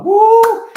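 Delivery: loud, high-pitched "woo"; excited whoop. Real, not acted. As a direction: let out a big fired-up whoop. Speaker fired up after preaching.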